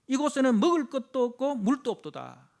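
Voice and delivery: loud, high declaimed grievance; loud scripture complaint. Retold or imitated. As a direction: cry out the lack of food and water. Quoting complaint with force.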